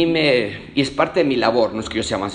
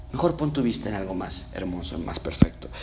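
soft whisper; 4 semitones lower, 5 dB quieter than usual. Real, not acted. Intimate closing advice.

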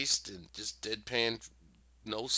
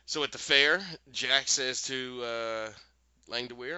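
normal talking voice

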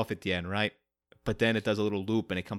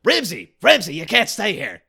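Christopher Lloyd impression; 5 semitones higher, 9 dB louder than usual. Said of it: "another Christopher Lloyd".

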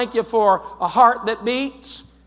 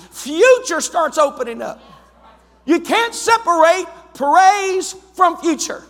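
normal talking voice

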